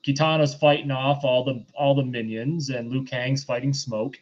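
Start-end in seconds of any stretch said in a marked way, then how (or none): none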